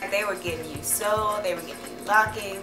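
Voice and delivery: ordinary talk, nothing marked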